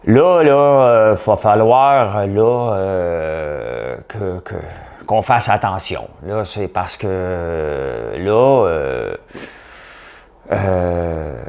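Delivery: halting premier, long drawn-out hesitations; grave, laboured political address at the microphone; François Legault. Acted. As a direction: stretch the fillers and vowels, slowly searching for words. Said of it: "quand il prend le micro, François Legault".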